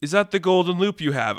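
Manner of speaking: a really nice guy asking politely; lightly voiced, mild-mannered polite interruption; Toucan Sam. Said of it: "like, this really nice guy".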